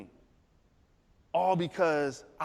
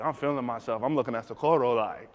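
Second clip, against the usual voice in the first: usual speaking voice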